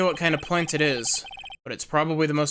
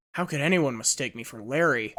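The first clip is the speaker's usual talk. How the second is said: ordinary talk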